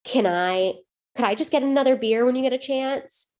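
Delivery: lightly acted customer calling for service; polite request, retold. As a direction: imitate a diner politely flagging down a server. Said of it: "stood up and just said".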